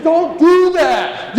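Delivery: very slow, high, loud "Don't do that"; slow, stretched warning to his wife; imagined plea. Retold or imitated. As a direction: draw each word out, pleading. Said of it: "I couldn't say it out loud, but I just wanted to".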